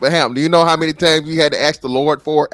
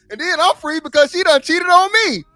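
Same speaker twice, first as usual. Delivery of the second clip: hyped mock preacher; 12 semitones higher than usual.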